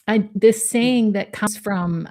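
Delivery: ordinary talk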